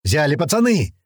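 rallying call; yelling. A narrator's reading. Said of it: "Это уже ору я".

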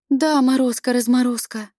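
plain reading voice

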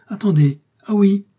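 loud, high burst of sudden recall; sudden exclamation. A narrator's reading. Young dancer suddenly remembering for the detective, sudden exclamation. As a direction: cry out, high and loud, as it comes back. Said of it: "puis tout à coup s'écria".

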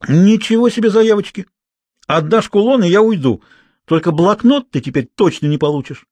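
put-on high-pitched, affronted girl; indignant retort. A narrator's reading. Girl haggles for her pendant in a quarrel, indignant. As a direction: throw it back, pitched well up.

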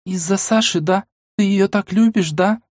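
put-on high, prying girl's question; curious girl voice. A narrator's reading. Mila asks. (любопытный девичий голос)

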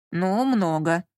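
resigned, sighed admission; sighing. A narrator's reading. Lyonya answers Lola about the number, sighing. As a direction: admit it with a weary sigh. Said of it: "вздохнул Лёня".

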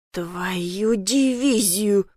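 very slow, drawn-out swear to oneself; inward cursing. A narrator's reading. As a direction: curse under the situation, stretching each word. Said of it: "мысленно выругался".

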